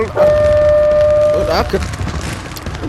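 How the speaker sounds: high cracked squeak; voice breaking. Acted. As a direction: let the voice crack. Voice breaks in distress.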